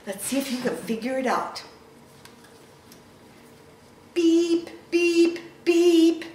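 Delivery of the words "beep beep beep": truck horn beeps. Acted.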